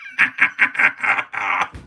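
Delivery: high, airless laugh; high breathless laugh. Real, not acted. Laugh after hype rant.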